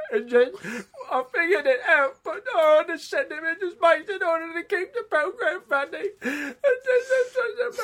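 hammed-up Cockney impression building to a high, loud, sobbing squeal; Michael Caine impression. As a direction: start measured, then break into exaggerated falsetto weeping. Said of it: "the Michael Caine impression".

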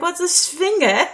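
a kitten asking what a swinger is. Retold or imitated. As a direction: ask it like a curious little girl. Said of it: "Why is she talking like that?".

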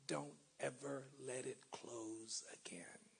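hushed urging. Acted.

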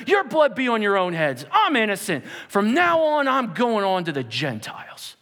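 loud, emphatic preacher's declaration; fired up. Acted. Paul renouncing those who opposed him, fired up. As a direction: proclaim it forcefully, rising on the last vow. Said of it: "it got him so fired up".

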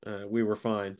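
normal talking voice

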